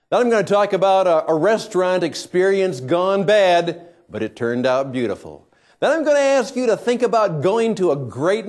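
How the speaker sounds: loud, punchy host opening; show intro announcer. Acted. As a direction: project a teaser for the show.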